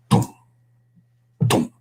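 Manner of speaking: short, spaced drum booms with the mouth; imitated drum beats. Storyteller imitates Vincent starting to drum, spaced drum booms. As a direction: voice single drum hits, pauses between.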